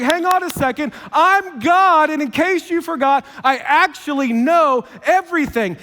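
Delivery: God answering our excuses, loud and certain; God preaching. Acted. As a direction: boom it out like an indignant God. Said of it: "God's like".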